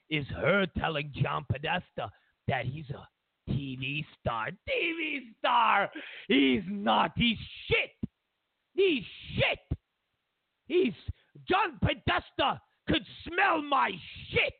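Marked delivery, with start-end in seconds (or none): 4.5-14.5 put-on high, indignant rant in comic Russian accent; outraged shouting. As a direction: explode at the title, drawing it out. Putin outraged by aide's report.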